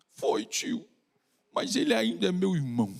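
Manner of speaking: put-on soft, little boy's heartfelt answer; child voice. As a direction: say it simply like a loving child. Child explains to the doctor. (voz de criança sincera)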